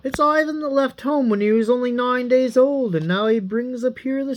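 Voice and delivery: put-on high, surprised old father; a father who can't believe his eyes; amazed father. Father welcoming lost Ivan home, amazed and high. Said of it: "rubbed his eyes ... and said".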